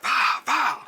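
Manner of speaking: high, loud mock punch sounds; punch sound effect. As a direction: pop out the punch noises. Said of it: "so he was like".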